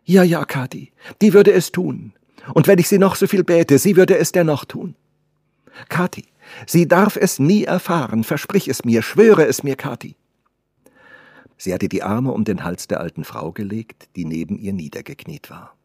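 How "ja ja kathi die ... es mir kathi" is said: put-on high, loud, insistent young mistress; agitated, raised-pitch plea; urgent pleading girl. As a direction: beg the servant to swear secrecy. Girl begs servant to swear secrecy.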